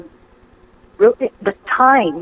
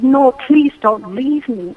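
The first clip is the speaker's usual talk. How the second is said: higher, drawn-out imitated plea; retold panicked plea; 6 semitones higher, 4 dB louder, slower than usual. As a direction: plead slowly, voice pitched up, mildly panicked. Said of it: "freaking out".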